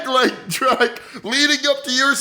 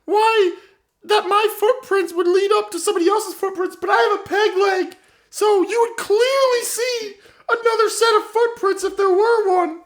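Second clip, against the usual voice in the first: put-on higher, rambling old woman owning up with a laugh; old lady confessing; 5 semitones higher than usual. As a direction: confess as the doddering grandma, pitched up. Grandma character confesses to murder.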